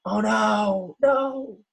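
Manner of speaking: high, desperate wail of no; cry of despair. Acted. The trapped ram cries out in despair at the wall. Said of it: "let out a cry of despair".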